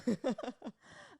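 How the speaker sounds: high bubbly giggle; a burst of high giggling; giggly. Real, not acted. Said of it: "I don't know why I'm so giggly today".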